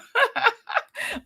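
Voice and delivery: short high-pitched giggle. Real, not acted. Teasing the chat about a theory, high giggle. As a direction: giggle at your own idea. Laughing at her own theory.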